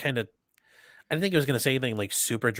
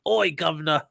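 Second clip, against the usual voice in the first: higher, mock British street call; British street accent; 5 semitones higher than usual. Joking about kids' accents, mock British street accent. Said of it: "British street accent".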